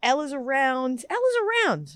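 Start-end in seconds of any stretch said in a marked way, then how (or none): 1.1-1.9 much higher, pointed repeat; pitched-up emphasis. Real, not acted. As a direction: jump up high to drive it home.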